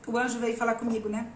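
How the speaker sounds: ordinary delivery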